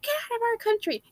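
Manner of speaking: high, fast caricature of a bigot. Retold or imitated. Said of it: "racist with the whip and, like".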